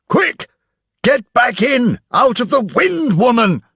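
shouting at the woman to get inside; urgent shout. A narrator's reading. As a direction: yell over the wind. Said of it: "he shouted".

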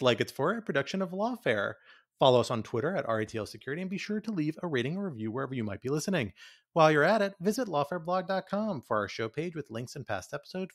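rapid, rehearsed sign-off read; podcast outro read. Real, not acted. Host to listeners at the end of this week's episode, podcast outro read. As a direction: rattle through the closing plugs.

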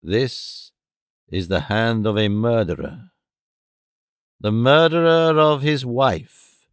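slow, portentous accusation; grave, deliberate fortune-teller's revelation; impressive pronouncement. A narrator's reading. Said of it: "and then spoke impressively".

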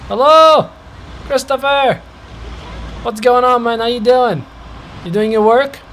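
loud, high playful greeting; calling out. Pretend. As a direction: holler up to your employee in the other bus. Greets his employee from afar.

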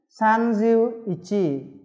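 teacher modelling numbers slowly; slow drill. Shown as a demonstration. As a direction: drag each number out for students to copy. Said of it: "các bạn sẽ đọc theo".

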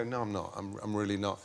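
low, soft retold denial; murmured brush-off to a stranger; hushed denial. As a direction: play it down, quiet and low.

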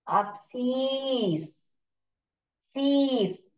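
"सीज" with a long, held ee; drawn-out pronunciation. Shown as a demonstration. Teacher modelling a verb form, drawn-out pronunciation. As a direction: stretch "सीज" slowly for learners.

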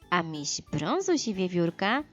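ordinary delivery

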